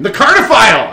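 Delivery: high, very loud theatrical proclamation; booming self-announcement. Acted. Character announces himself to new acquaintances, grandly shouting. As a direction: shout the name like a grand title.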